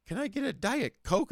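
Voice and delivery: high, light mock-innocent ask; mock friend voice. Friend just asks for a Diet Coke, high mock voice. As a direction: pitch it way up like a harmless request. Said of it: "if one of them says, like".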